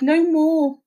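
high-pitched anguished plea; begging. A narrator's reading. Husband can bear no further torment, begging. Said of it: "he begged".

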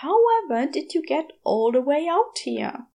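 a hint of a prim, worried angel; Aziraphale's voice. A narrator's reading. Aziraphale answers the shop girl's call, lightly voiced worried gentleman.

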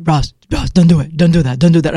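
alarmed warning. Retold or imitated. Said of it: "and he freaks out".

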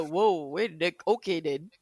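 quiet, low reaction; muttered quote. Retold or imitated. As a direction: murmur it in disbelief. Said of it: "A bit like the".

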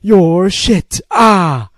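loud, high mock fan yell. Podcaster imagining Persian fans jeering at a match, yelling. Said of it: "the Persians yelling".

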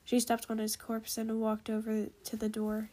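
usual speaking voice

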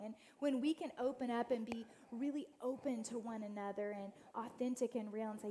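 usual speaking voice

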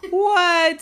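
high, loud startled squawk; pirate captain startled by the rainbow talk. Acted. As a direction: squawk it in disbelief. Captain's surprised outburst.

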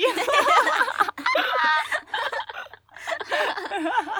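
high, breathy rising laughter; real breathy laughter. Real, not acted. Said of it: "息漏れしすぎ".

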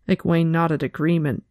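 ordinary delivery

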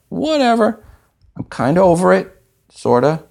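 high-pitched, sing-song shrug; mock dismissive. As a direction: protest too much that you don't care. Feigns indifference to the announcement.

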